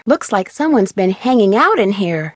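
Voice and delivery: ordinary talk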